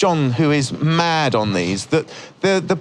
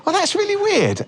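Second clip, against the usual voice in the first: high surprised. Retold or imitated. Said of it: "And I thought".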